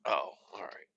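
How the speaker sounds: a soft breathy whisper to himself; whispered aside. Real, not acted. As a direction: let it out under your breath. Muttering to himself while driving.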